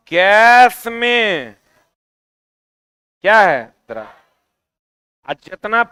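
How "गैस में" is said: loud, very slow lead-in for students; drawn-out prompt. Shown as a demonstration. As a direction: stretch "गैस में" and leave it hanging. Stretches words for class to complete. (ज़ोर से खींचकर पूछते हुए)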